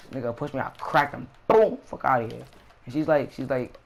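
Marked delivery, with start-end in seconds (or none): 1.5-1.8 sudden high, loud boom; explosive retold punch; punch sound effect. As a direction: imitate the impact with a burst. Imitates the punch landing.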